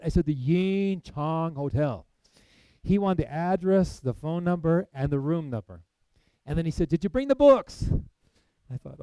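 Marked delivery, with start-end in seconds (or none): 0.2-2.1 very slowly repeated, with "Yin Chang" stretched long; drawn-out, exaggerated clarity down the phone; slow and emphatic. Repeating for a hard-of-hearing caller. 6.9-7.9 very high, loud shout into the phone; full high-pitched telephone holler; yelling. Retold or imitated. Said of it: "he yells in the telephone".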